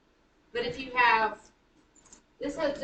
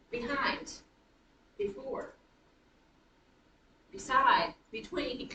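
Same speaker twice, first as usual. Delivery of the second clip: second syllable stressed in "behind, before, Beside, between"; light "be", then lean on the rest in "behind, before, Beside, between"; iambic stress; slower than usual. Shown as a demonstration. Teacher lists iambic examples, stressing the second syllable of "behind, before, Beside, between". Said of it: "unstressed syllable followed by stressed syllable".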